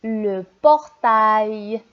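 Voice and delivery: "Le portail" said slowly with the ending "ail" drawn out; "portail" read slowly with a clear "aïe" sound; slowly read word. Shown as a demonstration. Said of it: "c'est A I L".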